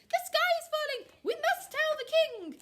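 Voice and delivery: loud, high bird alarm; squawking. A narrator's reading. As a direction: squawk it out in a panic. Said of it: "squawking and clucking and quacking".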